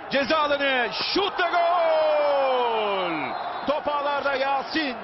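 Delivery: high, stretched goal yell; screaming football commentator. Real, not acted. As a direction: roar the winning goal. Late winning goal call.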